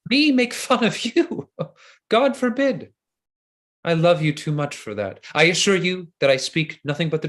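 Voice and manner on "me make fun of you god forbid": mock laughing denial. Seducer laughs off her suspicion.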